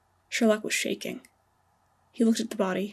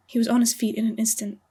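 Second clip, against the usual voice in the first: ordinary delivery, nothing marked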